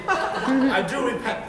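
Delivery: laughing slow repentance; scornful amusement; mocking laugh. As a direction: laugh off the old love. Lysander laughing at his past.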